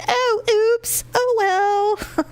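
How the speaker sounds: high, put-on little voice; mock sheepish oops. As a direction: play it up high, as if caught out. Retold reaction to being caught.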